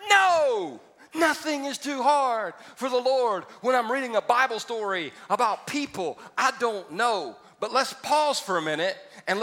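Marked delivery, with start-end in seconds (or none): 0.1-3.4 high, loud shouted No, then slow and firm; confident shout; mock confident shout. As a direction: boast it like it's easy. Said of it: "we're like".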